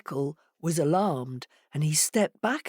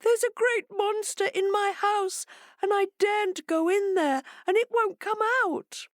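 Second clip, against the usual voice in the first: much higher, timid hare voice; small scared animal pleading; frightened hare. A narrator's reading.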